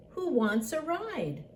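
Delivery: high little-truck voice; high, inviting storybook question; cheery invitation. A narrator's reading. Blue invites the animals aboard.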